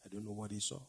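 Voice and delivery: ordinary talk, nothing marked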